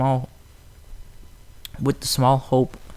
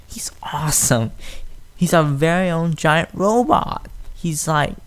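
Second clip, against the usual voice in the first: happy cry; 5 semitones higher than usual. A narrator's reading. Filly thrilled by her giant robot, excited cry. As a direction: gush with glee. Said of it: "cried happily".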